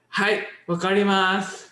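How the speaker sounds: mock robot-polite learner; overly polite. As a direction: sound cutely over-polite, like a textbook. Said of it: "it sounds kinda cute almost".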